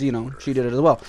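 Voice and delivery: usual speaking voice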